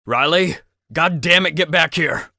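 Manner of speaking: high, loud angry call; furious shout after a fleeing person; shouting after her. Acted. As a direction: yell across the field for her to stop. Calling after someone running away.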